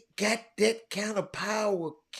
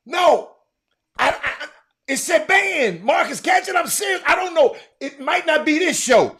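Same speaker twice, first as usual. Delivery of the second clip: loud high outraged shouting; loud high shocked yelling; 9 semitones higher, 8 dB louder than usual. Real, not acted. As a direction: yell it in disbelief.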